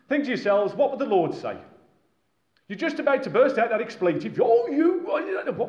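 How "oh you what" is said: high sudden flare of temper; mock angry outburst. Preacher acting out a flash of temper to the congregation. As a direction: snap as if about to swear. About to burst out an expletive.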